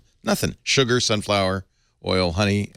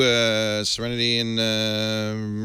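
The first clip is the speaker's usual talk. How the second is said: ordinary talk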